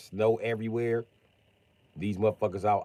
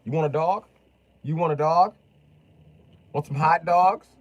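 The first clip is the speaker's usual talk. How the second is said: high, louder coaxing offer; 6 semitones higher, 7 dB louder than usual. Acted. Luring the homeless vet home, high coaxing voice. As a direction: lure him like a pet.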